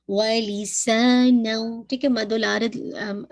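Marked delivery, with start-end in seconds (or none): 0.1-1.8 वलिसानौ slow, सा held for two counts; recitation with two-count सा. Shown as a demonstration. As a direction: stretch the सा, nasal नौ. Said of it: "टू count पढ़ना है". (सा को दो हरकत)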